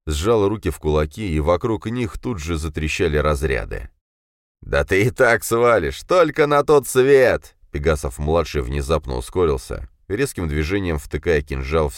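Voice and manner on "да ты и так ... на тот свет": menacing gloat. A narrator's reading. Killer's threat.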